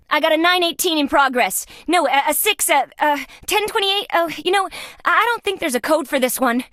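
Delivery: high-pitched, stumbling radio call; flustered. Acted. Officer radioing in an incident without a code for it, flustered. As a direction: fumble for the code in a high, rattled voice.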